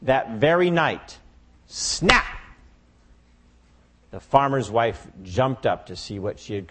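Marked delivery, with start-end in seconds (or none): 1.7-2.4 sharp high snap sound; trap snap. Acted. Mousetrap snapping shut at night, sharp high snap.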